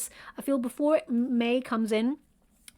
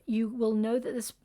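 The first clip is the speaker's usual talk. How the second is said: ordinary delivery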